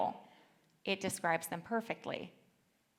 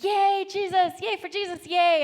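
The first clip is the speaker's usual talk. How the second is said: put-on high, loud little-kid cheer; cheering child; 11 semitones higher, 10 dB louder than usual. Five-year-old passing a cemetery, high loud mock cheering. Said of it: "he started cheering".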